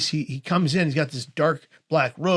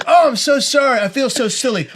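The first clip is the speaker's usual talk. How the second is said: high, loud retold apology that eases off; gushing, embarrassed imitation of a friar; apologetic; 9 semitones higher, 6 dB louder than usual. Friar realizes the patient told the truth, apologetic.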